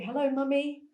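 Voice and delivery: put-on high, loud little-boy greeting; cheerful little boy. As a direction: call out to mum like a happy toddler. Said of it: "this boy who was always like".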